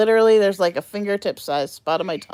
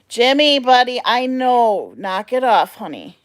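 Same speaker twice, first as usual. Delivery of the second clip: loud, slow scolding call; scolding the pet; 7 semitones higher, 8 dB louder, slower than usual. Real, not acted. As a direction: scold the pet bird loudly.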